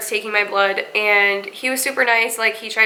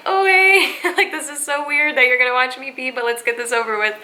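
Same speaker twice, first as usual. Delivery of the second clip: awkward cheery hello; 3 semitones higher than usual. Retold or imitated. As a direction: cover the awkwardness with brightness. Awkward greeting to watcher.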